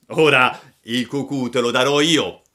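loud, threatening retort; bold shout. A narrator's reading. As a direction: answer the taunt like the boldest rascal. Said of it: "gridò il più ardito". (grido minaccioso)

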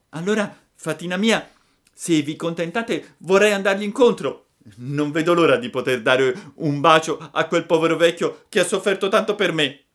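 high, loud, eager puppet voice; a boy who can't wait to hug his dad. Acted. Pinocchio eager to meet father. (burattino impaziente, voce alta e forte)

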